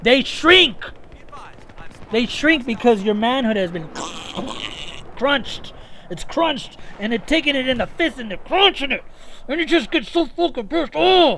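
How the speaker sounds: high, loud hammed-up fury; comic outburst of anger; mock rant. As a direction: work yourself into a fake rage. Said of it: "How was that rant?".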